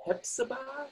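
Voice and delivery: lightly imitated Mid-Atlantic lilt; a touch of old Mid-Atlantic; Mid-Atlantic accent. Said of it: "like a Mid-Atlantic accent or something".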